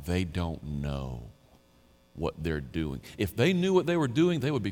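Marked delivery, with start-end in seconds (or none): 0.1-3.0 soft, slow; hushed and slow; gentle. Retold or imitated. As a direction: say it softly and slowly. Jesus quoted.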